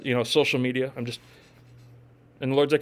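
ordinary delivery

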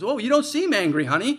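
loud high-pitched teasing reply; imitated wife. Wife answers in acted exchange.

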